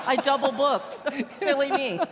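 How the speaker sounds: mock sheepish confession; playful self-reproach. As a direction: laugh off a silly slip. Acting out forgetful yellow double-booking.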